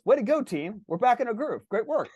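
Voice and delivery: high, chipper team congratulation; cheerleading rider. Acted. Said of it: "the rider hops in and he goes".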